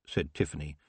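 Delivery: ordinary delivery, nothing marked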